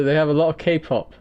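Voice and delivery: ordinary delivery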